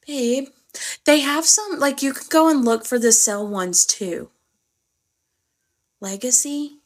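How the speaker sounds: usual speaking voice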